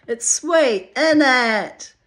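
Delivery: loud, high-pitched Cockney impression; Cockney accent. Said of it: "in her Cockney accent".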